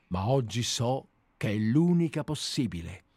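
plain reading voice

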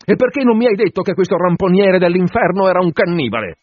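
high-pitched angry demand; indignant yell. A narrator's reading.

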